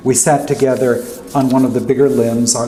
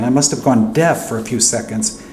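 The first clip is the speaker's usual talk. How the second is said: usual speaking voice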